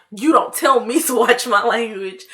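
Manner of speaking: plain reading voice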